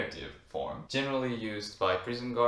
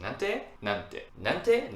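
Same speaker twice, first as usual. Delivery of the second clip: last nante jumps high and loud; rising repeated nante; 6 semitones higher, 4 dB louder, much slower than usual. Shown as a demonstration. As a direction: repeat it, climbing up at the end. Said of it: "Instead of".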